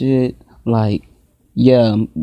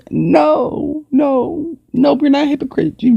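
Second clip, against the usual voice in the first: much higher, outraged protest; indignant no; slower than usual. Real, not acted. Reacting to a song calling her a hypocrite, high indignant protest. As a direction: shoot the accusation down fast, pitched up high.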